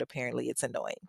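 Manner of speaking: normal talking voice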